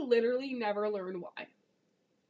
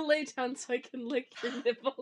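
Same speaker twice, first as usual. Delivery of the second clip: ordinary talk, nothing marked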